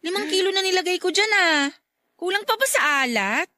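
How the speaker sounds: high, fast mock-offended comeback; playful sarcastic jab at her husband. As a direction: throw his complaint back at him, teasing. Wife teases husband about bland soup.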